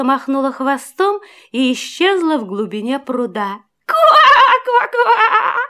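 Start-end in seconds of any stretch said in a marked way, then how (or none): 3.9-5.6 high, laughing croaks; laughing frog croak. A narrator's reading. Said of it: "засмеялась лягушка".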